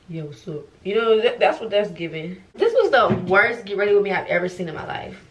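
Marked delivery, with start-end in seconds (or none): 0.1-0.7 lower, laid-back homeboy voice; a hint of a cool dude; mock homeboy. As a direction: play a chill homeboy greeting. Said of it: "This is giving".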